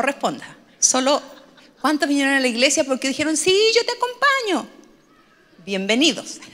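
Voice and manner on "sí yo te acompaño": high, sing-out yes; mock eager agreement. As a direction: imitate someone readily saying yes in a raised pitch. Imita a quien acepta ir. (entusiasmo imitado, en tono agudo)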